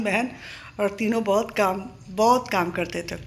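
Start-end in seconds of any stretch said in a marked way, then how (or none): none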